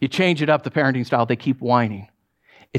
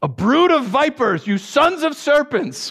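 prophet's denunciation; 10 semitones higher, slower than usual. Acted. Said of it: "and he goes".

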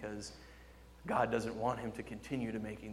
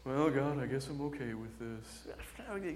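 fake resignation; 4 dB quieter, slower than usual. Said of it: "try and hide our feelings".

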